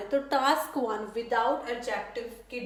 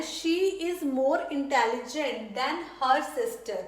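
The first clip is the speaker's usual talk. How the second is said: slow, word-by-word dictation pace; slow model sentence; much slower than usual. Shown as a demonstration. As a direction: spell it out slowly for the class. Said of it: "अगर मैंने compare करना है". (धीमे, साफ़ उदाहरण वाक्य)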